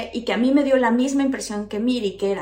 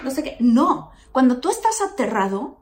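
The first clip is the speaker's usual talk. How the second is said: usual speaking voice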